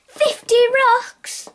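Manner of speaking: loud, slow, high astonished cry; can't believe the price; stunned outburst; shocked shout. Acted.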